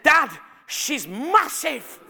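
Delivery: high, loud, drawn-out exclamation; imitated little girl. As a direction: blurt it like an astonished child. Imitating his loud young daughter.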